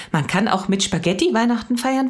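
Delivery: put-on high-pitched child's question; girl testing a wonderful idea; amazed. A narrator's reading. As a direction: keep the pitch way up, astonished. Mia amazed, asks further.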